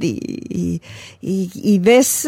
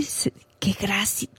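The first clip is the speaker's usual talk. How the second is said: a soft, breathless whisper of wonder; awed whisper; 11 dB quieter, slower than usual. Real, not acted. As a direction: drop to a whisper as if struck by the gift. Awe at the Eucharist. (susurro maravillado, casi sin voz)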